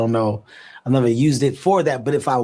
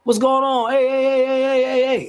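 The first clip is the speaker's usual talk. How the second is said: high, excited hype-up; rapid string of hyped hellos in a raised pitch; high-energy greeting; 12 semitones higher than usual. Retold or imitated. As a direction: burst into the room greeting everyone. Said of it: "clearly the energy ... I came in".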